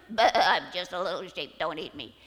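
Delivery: loud, low, quick bleated plea; bleating sheep lion. Acted. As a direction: plead like a frightened little sheep. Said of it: "the sheep lion goes".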